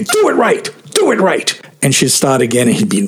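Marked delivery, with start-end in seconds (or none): 0.0-1.5 high-pitched, accented imitation of an angry order; irate conductor. Conductor stopping the rehearsal to correct his pianist, irate. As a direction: snap the order far above your usual pitch. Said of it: "he'd stop and say".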